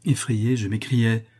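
ordinary delivery

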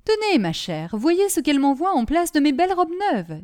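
showing off the rags in outrage; indignant. Acted. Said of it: "avec indignation". (madame Grébu, indignée)

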